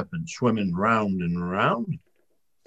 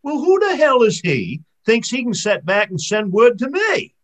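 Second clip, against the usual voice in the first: indignant; 9 semitones higher, 7 dB louder than usual. Acted. Charlie indignant at serpent.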